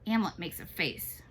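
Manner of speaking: usual speaking voice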